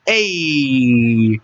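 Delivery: ordinary delivery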